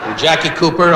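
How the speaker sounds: normal talking voice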